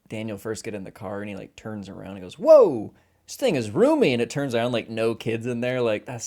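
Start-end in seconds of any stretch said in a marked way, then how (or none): 2.4-4.1 amazed. Retold or imitated. Impressed character in the car.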